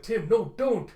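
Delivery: loud, high cry of protest; a friend crying out as Tim gives himself up; anguished shout. Acted. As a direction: yell to stop him. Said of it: "And Andy's like".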